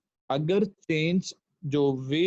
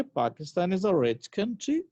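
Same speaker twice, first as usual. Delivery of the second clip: "Pakistan is a rich country" read as a question, rising on country; statement words turned into a question by a final rise on "country"; question intonation; 3 semitones higher than usual. Shown as a demonstration. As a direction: ask it slowly, lifting "country" at the end. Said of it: "तो अगर इसको ऐसे पढ़ेंगे".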